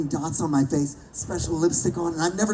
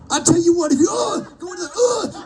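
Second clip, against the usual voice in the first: strained shouted read; 11 semitones higher than usual. Retold or imitated. Actor reading lines off a monitor in the booth, high strained shouting. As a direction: belt out the game line, straining high. Struggling through game lines while recording.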